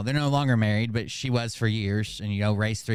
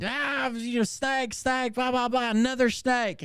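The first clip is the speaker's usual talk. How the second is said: high, garbled panicky imitation; mock panicked screaming; 11 semitones higher, slower than usual. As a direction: babble like a hysterical caller on speaker. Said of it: "she was screaming again".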